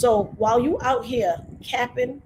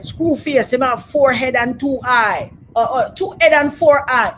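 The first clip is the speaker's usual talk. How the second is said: loud, slow, imitated Jamaican accent; a hint of a Jamaican movie villain; mock Jamaican accent; 3 semitones higher, 8 dB louder than usual. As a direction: Jamaican villain quote, lightly done. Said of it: "that horrible Jamaican accent".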